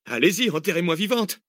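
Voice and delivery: high indignant sarcasm; indignant Cécile. Acted. Cécile getting dirt in her face, indignant.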